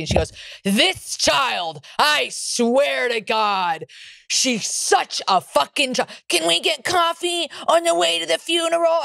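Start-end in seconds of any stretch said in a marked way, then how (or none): 0.6-6.1 raised, very slow mock complaint; exasperated mom. Mom griping to her friend about daughter, drawn-out exasperation. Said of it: "she proceeds to talk shit about me". 6.3-9.0 high mimicking whine; mocking mom. Mom mimicking daughter's coffee request, high mocking voice. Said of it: "she proceeds to talk shit about me".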